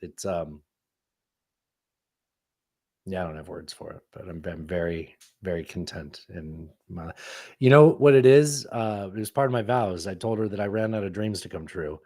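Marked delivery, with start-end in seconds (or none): 0.0-7.1 low, soft and tender; hushed, sincere newlywed contentment; heartfelt. Real, not acted. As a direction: speak quietly from the heart, smitten. Heartfelt talk about marrying Natasha.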